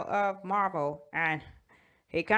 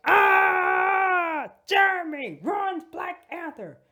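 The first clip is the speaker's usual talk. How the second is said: mock anguish; 10 semitones higher, much slower than usual. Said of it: "I'm like".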